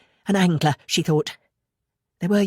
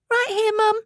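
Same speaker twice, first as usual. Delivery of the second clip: put-on young boy voice. A narrator's reading. Boy answers his mother.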